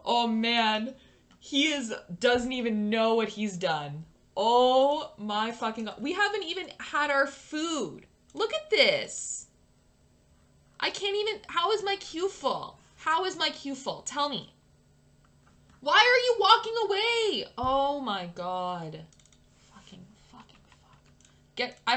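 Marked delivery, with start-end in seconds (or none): 0.0-19.0 exasperated outburst. Real, not acted. Player losing it as he walks away, exasperated. 19.7-21.0 very soft cursing under the breath; muttered swearing. Real, not acted. Player swearing to herself, muttered.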